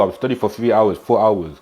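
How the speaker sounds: ordinary delivery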